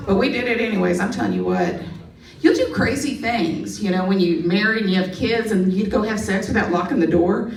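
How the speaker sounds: normal talking voice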